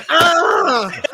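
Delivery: high sharp mock yelp; imitated cry of pain; retold yelp of pain. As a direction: yelp like a box just hit your head. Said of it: "and I'm going".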